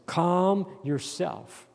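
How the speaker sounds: very slow, drawn-out calm yourself; slow calm command. Shown as a demonstration. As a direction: stretch out calm yourself slowly and steadily. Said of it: "I love this".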